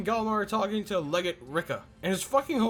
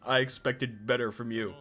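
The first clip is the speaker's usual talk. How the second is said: lower, disappointed scold; mock Jarl Balin; 9 semitones lower, 3 dB quieter than usual. Narrator voicing the jarl's scolding, lower. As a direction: drop low like a let-down elder. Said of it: "is all like".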